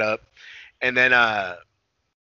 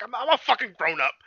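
defensive; 10 semitones higher than usual. Retold or imitated. Man defending himself, retold.

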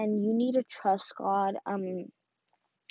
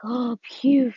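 mock relief; 2 semitones higher, 4 dB louder than usual. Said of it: "you're like".

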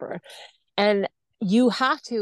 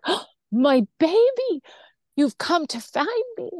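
mother's greeting; 7 semitones higher than usual. Retold or imitated. Bedridden mother greets her daughter.

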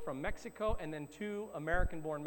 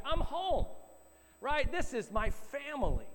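high, emphatic voicing of his joy; raised pitch, proud and emphatic; joyful declaration; 6 semitones higher than usual. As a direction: retell a son's elated sense of belonging. Retells son's joy at finding home.

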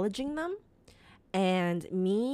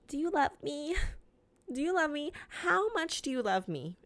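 clingy, softer, higher pleading; needy pleading; 8 semitones higher, 4 dB quieter than usual. Retold or imitated. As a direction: beg for reassurance like a clingy partner. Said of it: "I always say".

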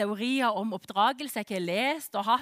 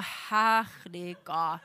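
very slow, low, drawn-out exclamation; low, long-drawn mock horror; stunned disbelief; 6 semitones lower, much slower than usual. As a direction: stretch every syllable out in retold dismay. Parent realises child called emergency line.

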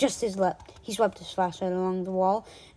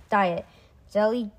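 usual speaking voice

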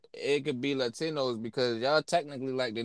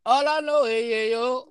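mock voice; 10 semitones higher than usual. Joking put-on line.

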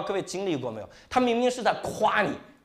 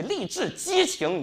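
"励志、激情" stretched out very slowly in a put-on inspirational voice; mock inspirational; 7 semitones higher, much slower than usual. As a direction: ham up the motivational-speaker tone, slow and grand. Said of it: "listing his own stage pitch".

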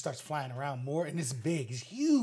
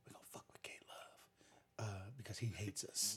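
whispered plotting of scheming insects; very soft, voiceless aside in character; conspiratorial whisper; 6 semitones lower, 15 dB quieter than usual. Acted. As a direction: whisper it like a secret plan. Voicing insects plotting against him.

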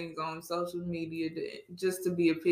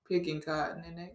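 usual speaking voice

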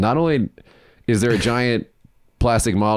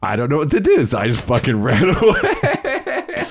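high, laughing retelling; 7 semitones higher than usual. Real, not acted. Comic tells of fleeing angry old men, laughing. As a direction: crack up while telling it, voice climbing high.

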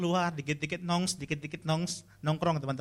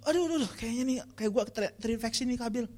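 higher whiny panic over a cough; hammed-up hypochondriac; 6 semitones higher than usual. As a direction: play the scared hypochondriac. Hypochondriac youth panicking, quoted.

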